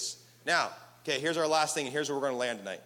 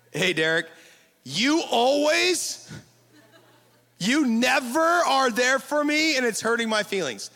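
high, loud, slow whiny complaint; accusing whiny tone; 7 semitones higher, 8 dB louder than usual. Shown as a demonstration. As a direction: whine it like a hurt accuser. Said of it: "that's option A".

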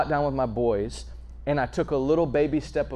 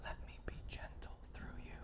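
whisper; 24 dB quieter than usual. Retold or imitated. God's whisper.

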